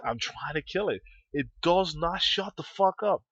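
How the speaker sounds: normal talking voice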